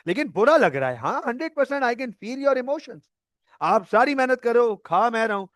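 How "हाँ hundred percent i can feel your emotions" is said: mock sympathy. Mock sympathy to upset partner.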